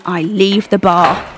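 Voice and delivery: ordinary delivery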